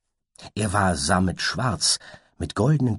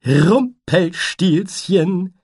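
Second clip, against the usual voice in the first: drawn-out, much higher joyful call; cheerful cry; 12 semitones higher, 8 dB louder, much slower than usual. Acted. Butterfly cheerfully cries out a wrong name, slow and high. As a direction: sing it out triumphantly, slowly. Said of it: "rief der Schmetterling fröhlich".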